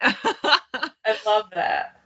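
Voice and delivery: slow, laughing through the words; laughing warmly. Real, not acted. Touched by the father story.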